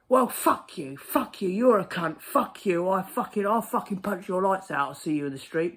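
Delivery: loud, high swearing tirade; furious threats. Retold or imitated. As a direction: hurl threats at the postman.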